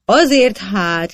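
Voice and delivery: loud, slow, halting retort; stammering shout. A narrator's reading. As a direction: shout it haltingly, like a tipsy judge. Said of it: "kiáltott dadogva a virítóképű törvénybíró". (akadozó, hangos felkiáltás)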